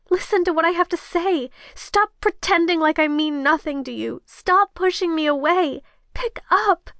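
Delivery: high-pitched, desperate pleading; choking on words. Acted. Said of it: "I was choking on my words".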